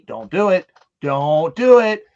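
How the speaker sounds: retold yell at the screen; screaming at TV. Viewer yelling at a horror movie character, retold screaming. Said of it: "I'm screaming at the T V".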